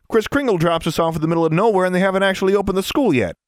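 put-on high, fast rant from a fed-up kid; griping schoolboy. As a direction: complain rapidly as an irritated boy. Voices Freddy's complaint.

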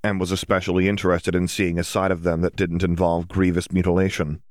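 plain reading voice

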